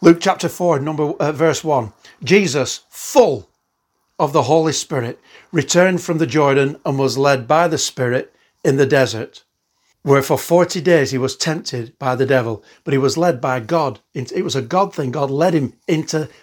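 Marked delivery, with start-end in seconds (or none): 2.2-12.6 louder, measured reading of scripture; proclaiming Bible reading. Acted. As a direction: read the verse out like from the pulpit. Reads Luke chapter four aloud.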